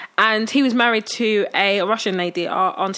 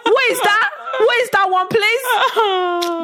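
laughing disbelief; 10 semitones higher, much slower than usual. Real, not acted. Sister mocking the aunt's pronunciation, high and laughing. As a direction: cry it out half laughing.

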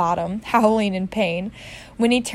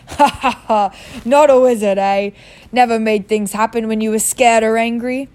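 loud, hearty chuckle into the words; chuckling; 6 dB louder than usual. Acted. Hagrid chuckles at Harry's doubt, loud and amused. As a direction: laugh it off, amused. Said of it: "Hagrid chuckled".

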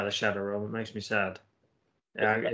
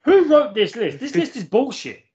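indignant outburst; 11 semitones higher, 7 dB louder than usual. Real, not acted. Outraged at the list.

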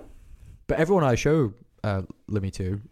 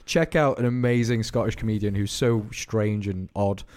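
ordinary delivery

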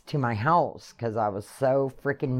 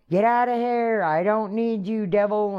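high, loud frantic yell; screaming at the devil; 10 semitones higher, 4 dB louder than usual. Retold or imitated. Woman yelling at the devil to leave, loud frantic scream. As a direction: scream it out like a crazy lady. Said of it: "I'm screaming in my house like a crazy lady".

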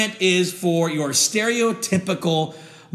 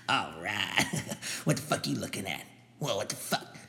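low, muttered tough-guy gym voice; low mumbling muscle-head bragging to himself; meathead gym bro; 9 semitones lower, 9 dB quieter than usual. Acted. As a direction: play a dumb swaggering bodybuilder under your breath.

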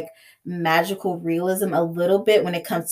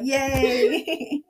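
mock yay; 6 semitones higher, 3 dB quieter than usual. Mock celebration of character's death.

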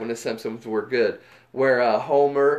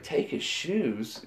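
soft, slow, deadpan echo; slow quiet repeat; 7 dB quieter, much slower than usual. Retold or imitated. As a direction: repeat it quietly, dragging each word. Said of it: "and he's like".

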